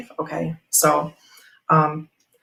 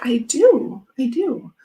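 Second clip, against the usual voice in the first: put-on child's eager yes; 8 semitones higher, slower than usual. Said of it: "he was like".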